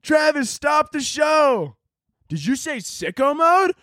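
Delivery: high, slow mocking shout; high mock yell at a rapper on stage; mock crowd shout. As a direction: heckle like someone in the crowd.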